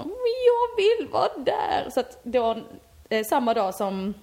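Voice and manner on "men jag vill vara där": put-on much higher and slow, a childish whine; whiny plea. Begging her mom to let her go, whining high and slow. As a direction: plead like a sulky kid who wants to go.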